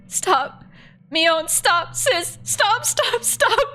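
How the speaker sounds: high, frantic pleas to stop; desperate begging. Acted. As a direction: beg your sister in panic. Shion begging sister to stop.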